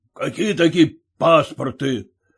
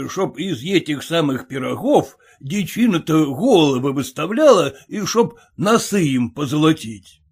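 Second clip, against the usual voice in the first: ordinary delivery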